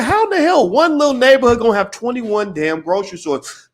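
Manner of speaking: high, loud retold disbelief; incredulous outburst. Retold amazed reaction to store count.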